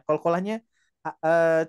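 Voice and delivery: ordinary talk, nothing marked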